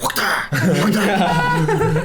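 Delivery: high honking trumpet laugh. Pretend. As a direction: blast it like a trumpet.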